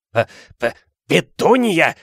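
stammering, then high gasped cry; Uncle Vernon gasping. A narrator's reading. Shocked uncle calling his wife, stammered gasp. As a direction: choke on the first sound, then burst out. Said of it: "ахнул он".

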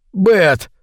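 high frightened shout of a name; startled cry. A narrator's reading. Man crying out to Бет as it leaps, startled cry. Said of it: "вскрикнул он".

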